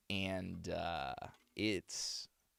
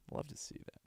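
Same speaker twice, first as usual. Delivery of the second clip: breathy unvoiced whisper; 9 dB quieter than usual. Real, not acted. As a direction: whisper it under your breath. Whispered repeat.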